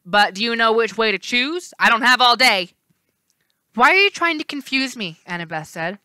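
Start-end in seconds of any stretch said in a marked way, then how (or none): 0.0-2.7 impatient. Acted. Left face impatient. 3.7-5.2 upset. Acted. Annabeth upset.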